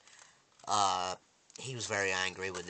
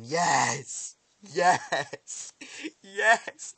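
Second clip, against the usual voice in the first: loud, high cries of triumph; ecstatic cheering; 9 semitones higher, 9 dB louder, much slower than usual. Retold or imitated. Viewer re-enacts cheering Negan on his knees, ecstatic shouts. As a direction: cheer each one harder, climbing in pitch.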